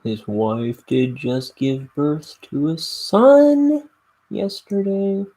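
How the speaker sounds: slow, loud Southern drawl; exaggerated Southern twang; southern accent. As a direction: play up a goofy Southern accent. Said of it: "Why did I go southern?".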